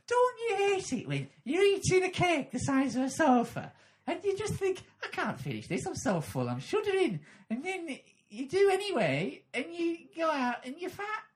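very high, loud telly-host voice; a squealing presenter doing stand-up; Fern Britton. Acted. TV presenter as a stand-up comic, very high and shrieking. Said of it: "just got possessed by a ghost of Fern Britton".

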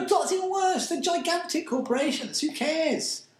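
high-pitched, indignant outburst; heated rant. Real, not acted. Arguing with co-host about giant corporations, heated high-pitched rant.

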